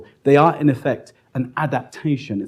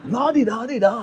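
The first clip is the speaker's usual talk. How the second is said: high lilting la-di-da, laughing through it; mocking singsong; 11 semitones higher than usual. Recalling the prisoner's taunt in a high laughing singsong. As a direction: mimic the heckler's taunt with a laugh.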